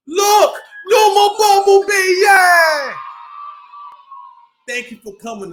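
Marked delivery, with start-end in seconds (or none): 0.1-2.9 high, loud happy shout; loud cheer. Acted. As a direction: cheer it for the kids. Cheer after song.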